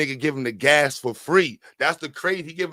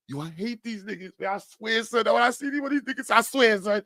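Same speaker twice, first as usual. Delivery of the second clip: crying hate rant; 5 semitones higher than usual. Retold or imitated. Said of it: "when they crying and hate".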